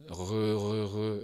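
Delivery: Re with the French throat R held longer; drawn-out French R. Shown as a demonstration. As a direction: stretch out the uvular R. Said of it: "on peut les faire durer plus ou moins longtemps".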